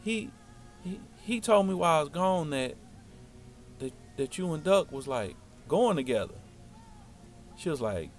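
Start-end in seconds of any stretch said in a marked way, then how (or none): 0.0-6.3 retold nervous stammer; halting stammer. Boy asking his crush about her and Duck, halting stammer. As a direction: stall and restart before getting it out. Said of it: "this how I'm talking".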